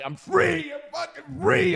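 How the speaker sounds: high, exultant shout of freedom; gleeful cry. Retold or imitated. Ex-con on the bus, exulting to himself, high joyful cry. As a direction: throw your arms up and cry it out high. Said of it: "I'm going like this with my hands high".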